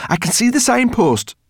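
high-pitched shout of discovery; excited cry. A narrator's reading. Sorrel tells the others what she sees, excited cry. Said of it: "cried Sorrel".

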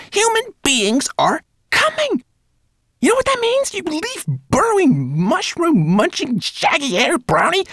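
very high, loud frantic rat tirade; shrill squeaking. A narrator's reading. Rat berating brownie about coming humans, shrill. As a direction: squeak the warning and insults shrilly. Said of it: "she squeaked so shrilly".

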